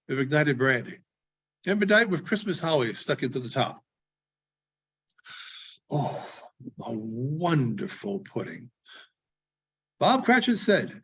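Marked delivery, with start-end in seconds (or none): 5.9-8.7 hushed, slow and low, in quiet awe; calm. Acted. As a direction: say it calmly and softly, lower and slower, like a proud secret. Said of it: "and calmly too".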